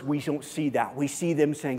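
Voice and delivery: usual speaking voice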